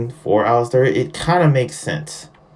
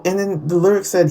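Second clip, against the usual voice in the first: normal talking voice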